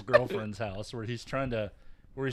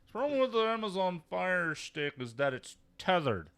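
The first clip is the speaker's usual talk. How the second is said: higher, self-assured expert explanation; imitating a cocky son, much higher; know-it-all tone; 8 semitones higher, 4 dB louder than usual. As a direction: explain it like the expert you aren't. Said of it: "I know what I'm talking about" tone".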